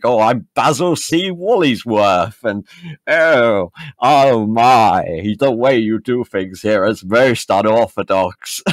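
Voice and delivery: hammed-up, plummy English screen villain; mock a stuffy TV villain from the London office. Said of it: "we're always the bloody".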